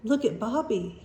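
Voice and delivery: ordinary delivery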